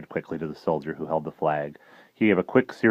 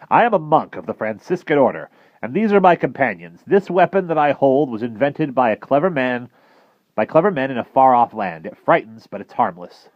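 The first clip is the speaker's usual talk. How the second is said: raised, earnest scholarly voice; formal old professor; 7 semitones higher, 6 dB louder than usual. Acted. Professor introducing himself to the admiral, formal and raised. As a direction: speak up politely to a stranger.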